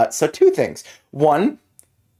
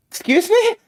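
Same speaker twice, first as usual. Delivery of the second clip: high startled question. Acted. Player startled by presence.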